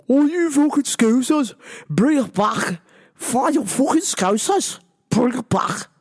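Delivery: a put-on Liverpool accent, mock tough. Shouting at Scousers in a put-on Liverpool accent. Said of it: "That's my best Liverpool accent".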